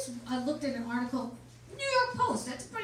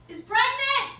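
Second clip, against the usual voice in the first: punchline shout; 14 semitones higher, 12 dB louder, slower than usual. Acted.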